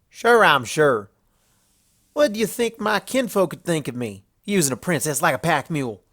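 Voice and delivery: a stubborn country mare refusing help; Applejack voice. Acted. Applejack turning down Luna's offer, folksy and firm.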